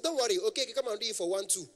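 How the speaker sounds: high-pitched dismissive caller; imitated high-voiced man. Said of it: "their voice is very, very high".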